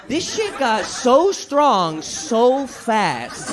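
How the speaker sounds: stretched slow and laughing; slow amused disbelief; slow drawn-out marvel. Real, not acted. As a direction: drag it out slowly. Stoner marvelling.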